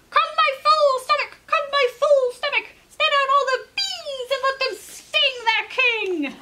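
high, loud rooster chant; rooster calling out. Acted. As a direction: summon the magic stomach like a spell. Rooster calls on his stomach.

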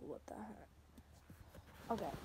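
slow whispered aside. Real, not acted. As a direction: breathe it out slowly under breath. Startled aside in dark room.

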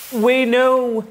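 loud, high, drawn-out interruption; exasperated storybook chorus; interrupting. A narrator's reading. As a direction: cut in emphatically, pitched right up. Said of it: "they all quickly interrupted".

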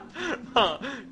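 laughing at his own silly mom voice; burst of laughter. Real, not acted. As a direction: crack up at the joke.

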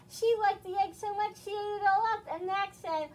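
lively storybook reading with voiced characters; reading to children. A narrator's reading. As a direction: read it to little kids, expressive. Reads picture book to children.

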